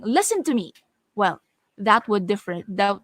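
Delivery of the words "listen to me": higher, more forceful command; made louder. Shown as a demonstration. Teacher showing a change in loudness, louder and higher. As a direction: raise the voice to demand attention. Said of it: "if I will make it louder".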